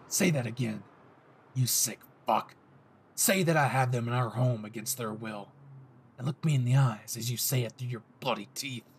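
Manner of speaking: low, threatening yandere growl; dangerous possessive boyfriend voice; menacing Bakugo. Acted. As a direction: dare him to repeat it, seething.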